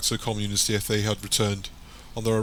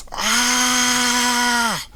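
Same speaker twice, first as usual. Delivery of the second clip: long, high, loud scream; agonized scream; 8 dB louder than usual. A narrator's reading. Tara backs away as Michael screams in agony. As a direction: hold a piercing cry of pain. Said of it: "moves back from the screaming Michael".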